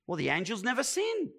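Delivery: ordinary talk, nothing marked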